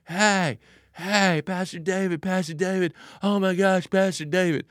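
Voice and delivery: high, excited mock yell across a mall; yelling fan. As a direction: call out like a thrilled fan spotting the pastor. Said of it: "somebody just starts yelling at you".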